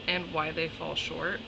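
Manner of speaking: ordinary talk, nothing marked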